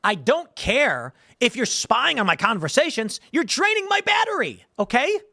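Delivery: high-pitched exasperated complaint; pissed off. Acted. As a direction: whine in comic outrage at the eavesdroppers. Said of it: "what pissed me off is".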